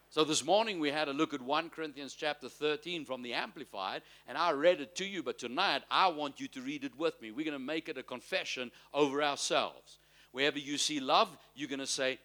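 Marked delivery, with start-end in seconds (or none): none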